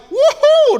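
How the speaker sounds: long, high, loud "woo-hoo"; rising-anger whoop. Retold or imitated. Preacher imitates his blood boiling up, loud high whoop. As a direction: let a drawn-out whoop shoot up high. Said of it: "saya naik darah".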